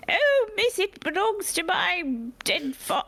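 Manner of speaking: high-pitched little street urchin; grubby urchin. Acted. Street urchin claiming a ring from a stranger, high pleading voice. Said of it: "some grubby little urchin".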